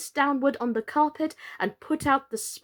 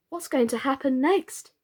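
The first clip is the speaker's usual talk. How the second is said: plain reading voice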